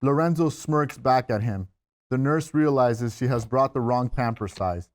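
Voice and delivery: usual speaking voice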